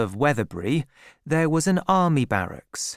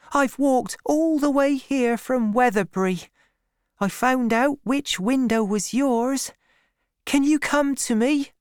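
put-on high, earnest girl's voice; young woman's high, entreating voice; Fanny Robin; 12 semitones higher than usual. A narrator's reading. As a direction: plead with your sweetheart, pitched way up.

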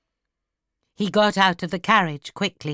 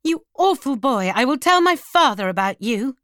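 high, indignant outburst; offended girl's raised-voice threat; angry cry; 7 semitones higher, 5 dB louder than usual. A narrator's reading. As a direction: scold him hotly, pitched high. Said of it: "she cried".

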